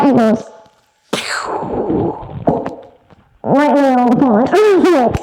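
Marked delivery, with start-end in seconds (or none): 1.1-2.6 breathy whooshing pew of a cast line; unvoiced pew sound effect. Cousin casts the rod. 3.4-5.2 loud, half-crying exclamation; loud exclaim of the cast landing; excited cry. Real, not acted. Disbelief as the cast flies far.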